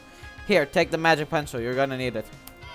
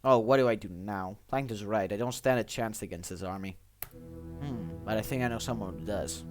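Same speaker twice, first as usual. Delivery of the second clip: lower, quieter despairing voice; despairing hero; 7 semitones lower, 8 dB quieter than usual. Acted. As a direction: sink into gloom, then ponder. Hero despairs, then thinks.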